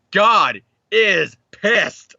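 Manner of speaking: very slow, drawn-out deadpan quote in a put-on voice; Brian from Family Guy. Quoting Family Guy's Brian about the comic, very slow put-on voice. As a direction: draw every word out like the cartoon dog. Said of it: "To quote Family Guy and Brian".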